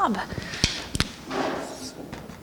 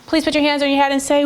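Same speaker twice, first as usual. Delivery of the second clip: ordinary talk, nothing marked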